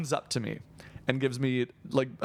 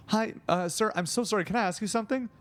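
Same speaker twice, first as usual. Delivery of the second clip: higher-pitched, apologetic stranger; older woman; 7 semitones higher, faster than usual. Retold or imitated. Older woman approaches the creator outside hotel, polite apology. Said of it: "like, an older woman".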